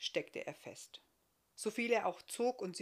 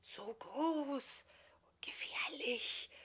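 secretive, sibilant whispering; hissing whisper; 6 semitones higher, much slower than usual. A narrator's reading. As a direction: whisper it slowly like unseen onlookers. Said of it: "ein Wispern und Zischeln".

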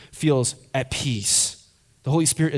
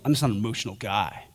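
mocking the indifferent guy, mumbled much softer and lower; mumbled mock shrug; 7 semitones lower, 7 dB quieter, faster than usual. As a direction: toss it off under your breath, mockingly. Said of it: "they're always like".

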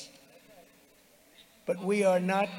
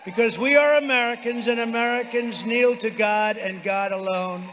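roaring rallying speech; 3 semitones higher, 5 dB louder than usual. Real, not acted. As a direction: roar it to the crowd.

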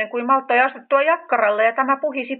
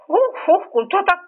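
breathless, higher fluster; higher flustered weasel miss, out of breath; flustered fussing; 7 semitones higher than usual. A narrator's reading. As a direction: puff and fuss like a flustered weasel lady. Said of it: "hössötti näätäneiti Sirlakka".

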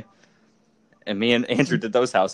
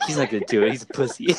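laughing mock taunt; faster than usual. Quoting Andrew's taunt.

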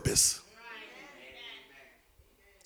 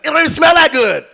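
high-pitched, raised-voice grumbling; hammed-up complaining customer; mock indignation; 8 semitones higher, 11 dB louder, faster than usual. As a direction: run down the merchandise in mock outrage. Acted shopper complaining about price.

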